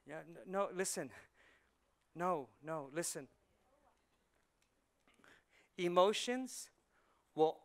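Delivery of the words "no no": ordinary delivery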